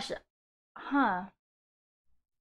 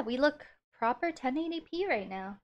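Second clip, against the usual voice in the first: ordinary delivery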